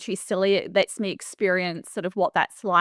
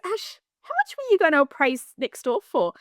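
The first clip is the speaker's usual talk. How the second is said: higher-pitched imitation of an eager caller; eager phone query; 7 semitones higher than usual. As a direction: retell his question in a raised, keen voice. Partner's urgent phone call, retold.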